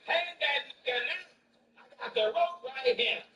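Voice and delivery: loud mock mob shout; mob yell. Villager rallies the mob, loud mock shout. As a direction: bellow it like an angry lynch mob. Angry mob man quoted.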